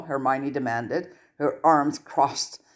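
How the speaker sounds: plain reading voice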